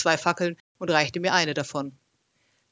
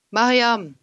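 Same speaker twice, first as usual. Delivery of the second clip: loud call into the dark; 6 semitones higher, 6 dB louder than usual. A narrator's reading. Narrator calls to Mariam in the dark, loud call. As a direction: call her name into the darkness. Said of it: "rief ich durch die Dunkelheit".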